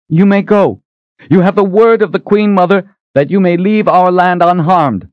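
put-on high, loud commanding old queen; Queen Mother calling out. A narrator's reading. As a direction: call out over the crowd as the queen.

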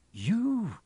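shocked gasp, pitched high; high, startled question; gasped disbelief. A narrator's reading. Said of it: "gasped Harry".

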